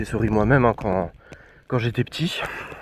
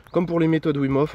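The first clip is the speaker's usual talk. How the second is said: normal talking voice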